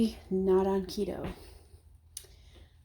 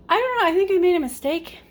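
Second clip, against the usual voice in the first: surprised; 9 semitones higher, 5 dB louder, faster than usual. Real, not acted. Realizing cooking mistake.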